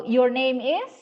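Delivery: "Your name is" rising on "is"; "is" climbing, waiting for an answer; questioning intonation. Shown as a demonstration. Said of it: "itu kan intonasi bertanya".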